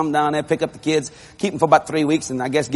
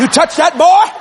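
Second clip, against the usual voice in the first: high, raised-voice warning; 8 semitones higher, 6 dB louder than usual. Retold or imitated. Daddy warns man about his wife, emphatic. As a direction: act out an emphatic, rising warning.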